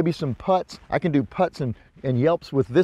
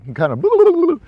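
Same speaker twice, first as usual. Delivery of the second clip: loud high gobble sound; turkey gobble imitation; 7 dB louder, slower than usual. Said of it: "he gobbles back".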